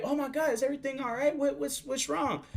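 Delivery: high-voiced mock concern from coworkers; worried coworker. Coworkers ask what's wrong.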